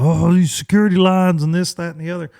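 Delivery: high, put-on whine about airport hassle; mock the moaners in a nasal-free sing-song whine; mock whiny complaint. Griping traveller on social media, mock whiny complaint. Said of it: "social media platform that you like to bitch on".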